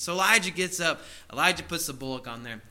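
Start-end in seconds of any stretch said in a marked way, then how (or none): none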